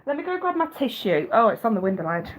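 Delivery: ordinary talk, nothing marked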